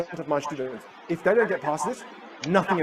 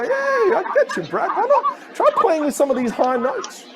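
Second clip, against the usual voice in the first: very high, singsong coaxing; squeaky-high encouragement; playful high notes; slower than usual. Shown as a demonstration. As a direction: coax brightly way up in head voice. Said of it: "experiment with some of the higher notes".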